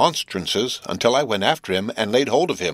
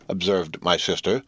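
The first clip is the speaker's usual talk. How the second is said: ordinary delivery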